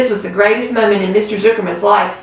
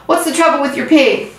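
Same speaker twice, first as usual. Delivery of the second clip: alarmed announcer; 6 semitones higher than usual. Acted. Announcer alarmed at fainting pig.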